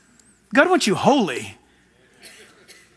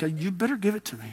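hushed demand; 10 dB quieter, faster than usual. Retold or imitated. Hidden demand inside prayer.

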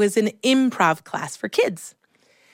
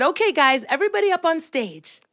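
lightly voiced, higher drama teacher; brisk, higher-pitched call to a class; 7 semitones higher than usual. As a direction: round up the kids. Teacher calling children onto stage.

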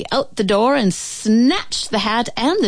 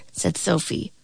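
usual speaking voice

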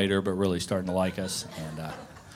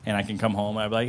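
usual speaking voice

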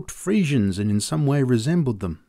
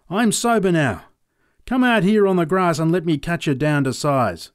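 yelled challenge; 6 semitones higher, 5 dB louder than usual. Acted. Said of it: "I yelled at him".

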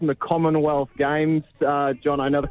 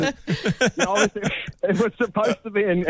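ordinary delivery

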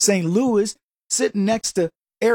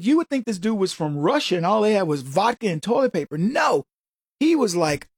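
ordinary talk, nothing marked

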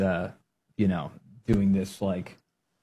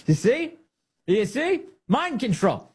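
mock high voice; 12 semitones higher, slower than usual. Mocking high voice.

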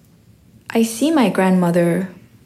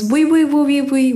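higher-pitched, rapid-fire pleading; insistent urging; 6 semitones higher than usual. Retold or imitated. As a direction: imitate a grandmother pushing a gift on you. Acting grandmother pressing money on her.

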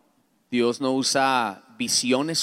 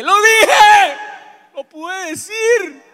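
high, drawn-out victory yell; triumphant shout; 13 dB louder, much slower than usual. Retold or imitated. Man finally admitting he needs help, shouting in triumph. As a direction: scream it out in release. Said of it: "yo grité y dije".

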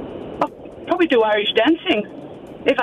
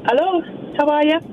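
lightly lilting Irish accent, pitched up; 3 semitones higher, faster than usual. Real, not acted. Caller back as [name] greeting hosts, Irish accent. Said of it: "[name], you've got an accent now".

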